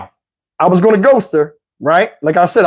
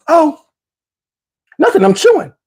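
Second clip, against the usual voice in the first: mock casual woman; 8 semitones higher than usual. Date answers, voiced by him.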